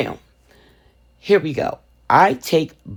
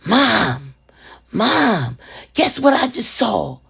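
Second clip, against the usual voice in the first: higher, lightly voiced little boy; imitating a toddler; 7 semitones higher, much slower than usual. Mother imitating her toddler's excited secret, light child voice. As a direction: mimic a small excited son.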